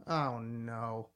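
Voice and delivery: low, softly groaned dismay; retold dread. Host recalling hearing the first track, quietly dismayed. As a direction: mildly let down, voice sinking. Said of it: "I just go".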